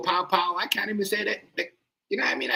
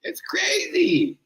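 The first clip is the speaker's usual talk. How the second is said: drawn-out crazy; much slower than usual. Real, not acted. Exclaims about banned words.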